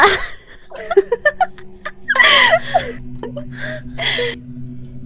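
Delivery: shrieking laughter; high squealing laugh. Real, not acted. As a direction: burst out squealing. Laughing fit. (quietschendes Lachen)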